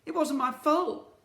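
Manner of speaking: high, loud stammering plea; beg, stumbling over the buts; pleading boy. Acted. Boy pleading with the twins, high stammering plea. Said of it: "pleaded Ned".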